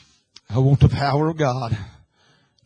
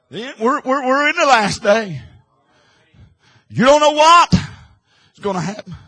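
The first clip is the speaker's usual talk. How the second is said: climbing higher and louder as it builds; rising preaching; 9 semitones higher, 7 dB louder than usual. Real, not acted. Preacher building up before the church. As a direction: build the sermon to a cry.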